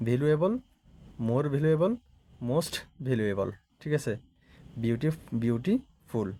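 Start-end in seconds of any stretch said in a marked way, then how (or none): 4.8-6.3 "beautiful" slowly, with a gap before "ফুল"; split in two. Shown as a demonstration. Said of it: "এখানে দুইটা syllable". ("Beautiful" ধীরে দুই ভাগে ভেঙে)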